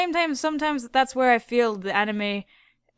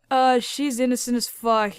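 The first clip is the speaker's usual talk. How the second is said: ordinary talk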